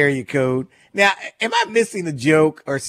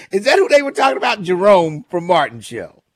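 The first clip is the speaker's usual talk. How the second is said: usual speaking voice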